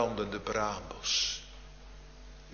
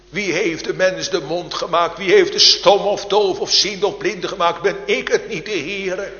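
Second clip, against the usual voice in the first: the verse proclaimed louder and higher; ringing preaching; 7 semitones higher, 11 dB louder than usual. Acted. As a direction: call God's question out to the congregation. Same verse repeated in climax.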